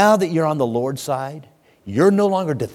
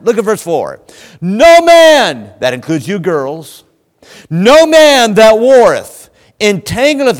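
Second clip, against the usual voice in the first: high and loud preaching, pressing every line; full-force preaching; 9 semitones higher, 9 dB louder than usual. Acted. Preacher exhorting his congregation, fiery and loud. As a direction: preach it hard to the pews.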